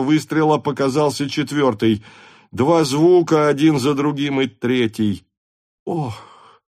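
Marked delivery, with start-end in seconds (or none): 5.8-6.6 pained gasp of "ох"; a sudden groan as if burned. A narrator's reading. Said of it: "охнул Несвицкий, как будто от жгучей боли".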